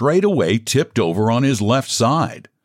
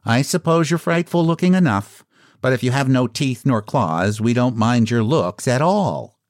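higher, unimpressed clown voice; indifferent clown; 4 semitones higher than usual. A narrator's reading. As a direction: concede it airily as a clown. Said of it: "said the clown indifferently".

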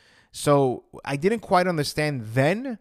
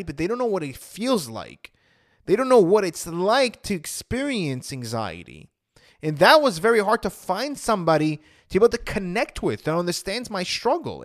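usual speaking voice

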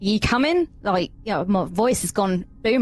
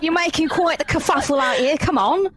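high, slipping Cockney voice that drifts Australian; Cockney attempt; 8 semitones higher than usual. Character beckons the others inside, slipping Cockney accent. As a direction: call them in like a cheeky Londoner. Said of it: "going back to Australian again".

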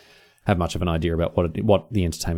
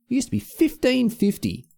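wistful complaint. Real, not acted. Lamenting old price.

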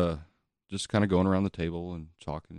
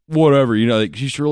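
ordinary talk, nothing marked

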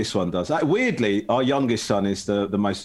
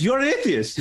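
high, shocked "You're an atheist?"; shocked question; 12 semitones higher than usual. Retold or imitated. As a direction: mum gasps it in disbelief. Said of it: "She goes".

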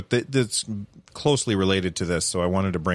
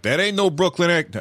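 mock Brooklyn objection, pitched up; Brooklyn accent; 7 semitones higher than usual. Brooklyn native objecting to the impression, higher mock Brooklyn accent. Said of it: "don't get mad at me for doing the accent".

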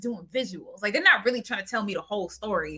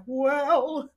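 mock musical sadness; 4 semitones higher, much slower than usual. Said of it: "then they're like".

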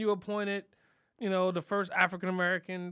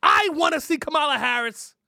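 loud hammed-up protest shout, higher; mock shouting rant; 8 semitones higher, 9 dB louder than usual. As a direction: bellow the demands at the president. Rant demanding Kamala Harris.

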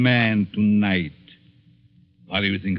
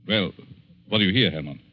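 usual speaking voice

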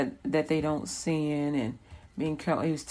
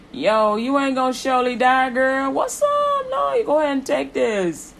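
high, loud street-slang tempter voice; mock the smooth-talking serpent, much higher and louder; sassy snake; 9 semitones higher, 7 dB louder than usual. Preacher mocking the snake tempting Eve, high and loud.

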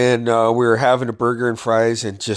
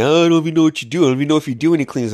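mocking teacher voice; 5 semitones higher, much faster than usual. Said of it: "They fucking tried telling me".

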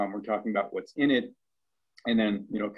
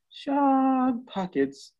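much higher, very slow, drawn out; mock deadpan pitch; 4 dB louder, much slower than usual. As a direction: drop the punchline slowly in a put-on voice. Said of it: "Tom will lean over and say".

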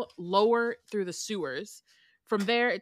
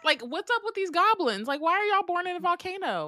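way up in pitch, mock disbelief; incredulous high rant; 11 semitones higher, 3 dB louder than usual. Real, not acted. Incredulous rant about goblins.

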